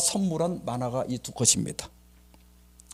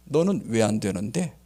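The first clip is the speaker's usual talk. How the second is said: lightly voiced God, lower pitch; God answering; 6 semitones lower than usual. God questioning the man who suffers, calm low challenge. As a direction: put the question back calmly, low.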